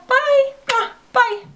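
very high, cutesy goodbye; high-pitched sing-out bye; squeaky sign-off. Real, not acted. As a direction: chirp a farewell with a blown kiss. Signing off the video to viewers.

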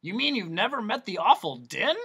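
mildly surprised doctor. Acted. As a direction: sound astonished. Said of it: "in a surprised tone".

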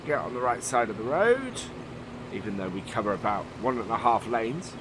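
normal talking voice

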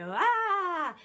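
a sudden high, loud shriek of panic; hysterical scream. Retold or imitated. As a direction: scream into the phone, half angry, half hysterical. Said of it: "quite hysterical and angry".

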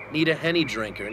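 normal talking voice

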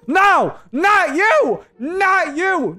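high, loud panicked yells; panicked shout. Real, not acted. Gamer yelling at the wrong player in his car. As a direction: shout to keep him out of the car.

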